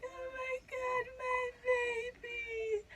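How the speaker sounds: very high, drawn-out re-enacted weepy wail; overwhelmed, emotional. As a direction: squeal it slowly, as if bawling with joy. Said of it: "I'm so emotional".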